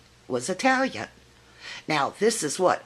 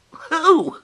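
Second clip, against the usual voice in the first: mock disbelief; 12 semitones higher, 9 dB louder than usual. Mock disbelief at not knowing [name].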